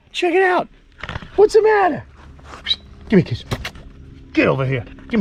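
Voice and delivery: very high-pitched call to a dog; high dog-talk. Real, not acted. Owner rousing his dog to look, high excited pet voice. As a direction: pitch it way up to get the dog's attention.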